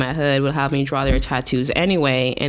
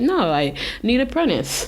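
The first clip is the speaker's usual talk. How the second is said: put-on raised pitch, heavy Russian accent on the tattoo artist; lightly done Russian accent, pitched up; hardcore Russian accent; 8 semitones higher than usual. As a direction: play the gruff Russian tattooist in a thick Russian accent. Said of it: "this hardcore Russian accent".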